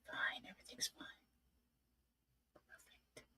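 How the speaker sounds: breathless quick whisper; whispered brave face. Retold or imitated. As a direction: whisper it fast, pretending all is fine. Pretending all is fine to daughters.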